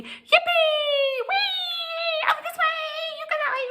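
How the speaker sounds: very high gleeful playground cries; gleeful shouting. A narrator's reading. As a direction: shriek with joy while playing. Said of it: "laughed and danced".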